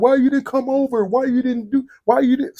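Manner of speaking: high-pitched mock complaint; imitated girlfriend. Girlfriend quizzing him for not coming over, high imitated complaint. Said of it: "She'd be like".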